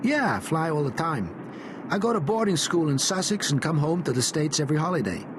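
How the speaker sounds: high, breezy American schoolboy showing off; lightly voiced confident American kid; American boy voice. A narrator's reading. Walter voiced in story.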